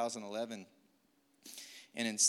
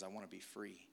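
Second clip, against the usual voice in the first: a much softer, hushed plea; a hushed plea to be set free; soft inner cry; 4 semitones lower, 12 dB quieter than usual. Retold or imitated. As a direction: breathe it out like a quiet longing. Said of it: "That was the cry of my life".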